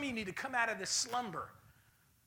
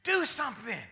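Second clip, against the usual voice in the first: ordinary delivery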